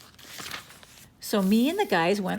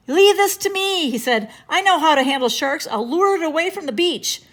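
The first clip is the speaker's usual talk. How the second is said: heroic lifeguard; 8 semitones higher, 6 dB louder than usual. A narrator's reading. Mr. Sunny heroically taking charge.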